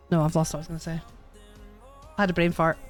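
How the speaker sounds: ordinary talk, nothing marked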